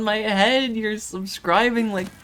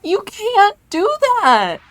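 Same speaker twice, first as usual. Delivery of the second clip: high, loud, slow protest of disbelief; high disbelieving shout; 6 dB louder, slower than usual. Real, not acted. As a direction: cry out in overwhelmed protest. Streamer protests at donations.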